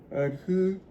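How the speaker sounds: words stretched by a yawn. Real, not acted. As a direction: talk through a yawn. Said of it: "I don't know why I keep yawning".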